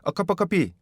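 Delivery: "A cup of coffee" run together as "ak apa k api"; typical Korean mispronunciation. Shown as a demonstration. Teacher demoing the common learner's mistake. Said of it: "우리나라 사람들의 대부분이 이거 어려우실 거예요".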